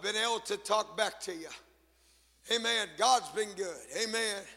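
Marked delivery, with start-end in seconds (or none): none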